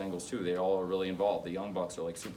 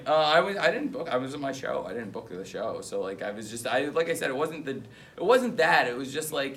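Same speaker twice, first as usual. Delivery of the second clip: ordinary talk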